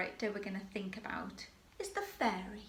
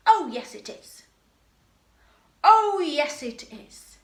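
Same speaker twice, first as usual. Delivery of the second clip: loud, high cheeky "Oh, yes" then a drop; panto dame retort; 5 semitones higher, 12 dB louder than usual. Shown as a demonstration. Teacher models the cheeky dame's reply, loud and high. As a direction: fire back the dame's reply, cheeky and big. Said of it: "I would like you to say".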